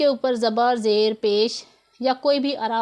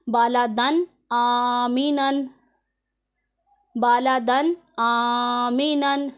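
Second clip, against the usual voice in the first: very slow, drawn-out recitation of Bala दन आमीनन; Quranic recitation; much slower than usual. Shown as a demonstration. Teacher reciting the tanween example slowly for her students. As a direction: chant Bala दन आमीनन slowly with a clear तनवीन. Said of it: "इसे हमने पढ़ना है".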